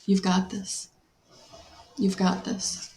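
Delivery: quiet, voice barely holding together; pushing words out through real tears; choked up. Real, not acted. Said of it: "I'm shaking".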